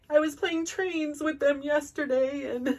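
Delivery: high, tearful and breaking; laugh-crying through the memory. Real, not acted. Mother recalling play with her kids, tearful.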